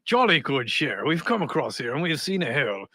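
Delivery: low, mock-English copper's voice; Monty Python-style English accent; old-school English policeman. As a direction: play an old-fashioned English bobby. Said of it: "some old school English dude".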